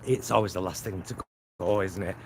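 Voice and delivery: ordinary delivery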